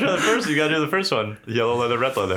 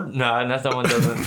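usual speaking voice

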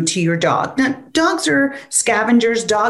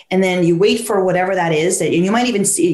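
ordinary delivery

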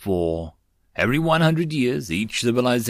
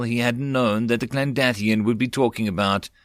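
usual speaking voice

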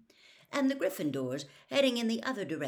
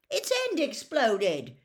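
louder, higher, slow complaint; angry; 8 semitones higher, 8 dB louder, slower than usual. A narrator's reading. Dean shows Hagrid his burnt hand, angry. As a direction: protest the burn hotly. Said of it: "said Dean angrily".

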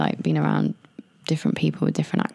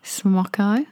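rising Australian try at "Smocko"; attempted Australian accent; 2 semitones higher, much slower than usual. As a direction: test "Smocko" in mock Australian. Trying slang in Australian accent.

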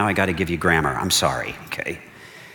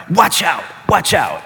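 high, loud urgent cry of warning; shouted warning; 9 semitones higher, 5 dB louder, slower than usual. Retold or imitated. Prophet warning King Asa after the battle, loud urgent shout. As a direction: shout it out to stop them in their tracks. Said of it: "It's like he's saying".

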